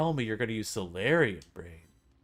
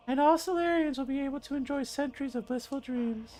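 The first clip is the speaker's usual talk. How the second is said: put-on high old lady voice; a dreamy elderly noblewoman. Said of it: "my old lady voice".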